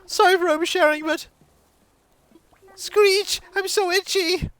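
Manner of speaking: villager voice. Acted. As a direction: squeal the villager's lines up high. Voices game villager lines.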